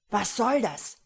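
shouted demand; roared. A narrator's reading. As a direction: roar it in outrage. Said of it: "brüllte Dwyer".

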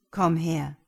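loud, firm, controlled order; composed but commanding; calm command. A narrator's reading. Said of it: "befahl er mit ruhiger Stimme".

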